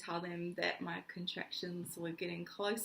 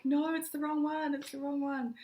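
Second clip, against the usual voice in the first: panicked correction; 7 semitones higher, 5 dB louder than usual. Retold or imitated. Wrong turn-off in the car.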